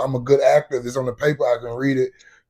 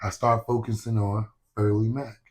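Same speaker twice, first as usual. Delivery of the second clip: normal talking voice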